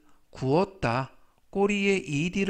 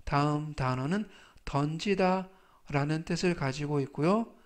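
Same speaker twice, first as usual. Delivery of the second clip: ordinary delivery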